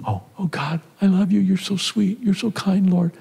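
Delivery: high, syrupy fake devotion to God; mock sweet praise. Believer praying with put-on sweetness, high mock gushing. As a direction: gush sweetly while hiding anger. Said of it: "It's like say".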